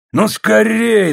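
high, drawn-out impatient yell; impatient shout. A narrator's reading. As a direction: shout to hurry someone up. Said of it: "закричал Полос с нетерпением".

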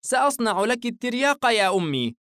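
put-on high little boy's voice; earnest young boy. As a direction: play a child proudly making medicine for mother.

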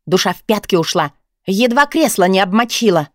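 usual speaking voice

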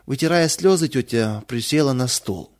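ordinary delivery

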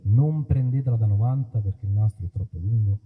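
ordinary talk

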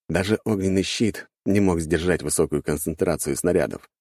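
ordinary delivery, nothing marked

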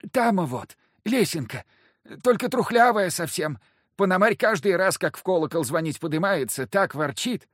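high, nervous priest; lightly voiced fussy priest.

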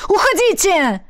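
high, urgent yell; shout. A narrator's reading. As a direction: yell for them to leave. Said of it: "выкрикнул Эммет". (срочный громкий выкрик)

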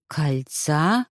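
low, thoughtful question; musing, voice dropped, rising at the end; pondering. A narrator's reading. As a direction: echo it as you think it over. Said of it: "задумавшись".

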